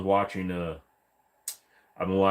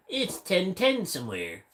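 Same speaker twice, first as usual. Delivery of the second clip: higher, slow put-on Khan voice; 9 semitones higher, slower than usual. Streamer trying a Khan voice for chat, higher and slow. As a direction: drawl it out as Khan from King of the Hill. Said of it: "I'm going to talk like this".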